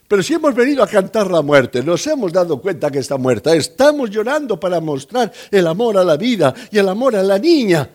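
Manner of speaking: loud, fast, high-pitched objection; indignant mourners' protest. Acted. Hired mourners objecting that they came to weep, indignant protest. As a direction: rattle out an acted protest at full volume.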